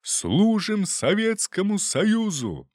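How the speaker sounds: slow, high, drawn-out shouted oath; joyful bark. A narrator's reading. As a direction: bellow it proudly in unison. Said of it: "радостно гаркнули мы".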